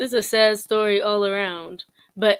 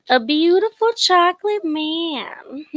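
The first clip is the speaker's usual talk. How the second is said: high, slow, louder lustful swoon; mock swoon; 9 semitones higher, 8 dB louder, slower than usual. Mocking his fans to viewers, high slow swooning. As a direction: savor it like a fan.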